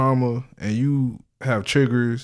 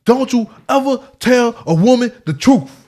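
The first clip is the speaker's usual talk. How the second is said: loud [name] lyric; 12 semitones higher, 9 dB louder than usual. Retold or imitated. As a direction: shout the line high and loud, like a rap hook. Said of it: "what did he say? [name] say?".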